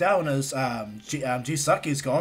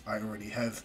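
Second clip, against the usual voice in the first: low and soft, almost under the breath; sheepish low reply; low, quiet admission; 6 semitones lower, 9 dB quieter than usual. Retold or imitated. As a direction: admit it quietly, pitched down. Blushing Bakugo admits it to Izuku.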